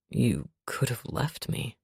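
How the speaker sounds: deep, soft and slow; quiet low voice. A narrator's reading. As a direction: say it quietly, almost hushed. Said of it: "his voice goes quiet".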